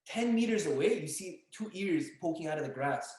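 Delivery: ordinary delivery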